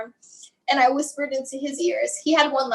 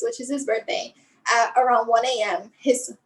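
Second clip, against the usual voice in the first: ordinary talk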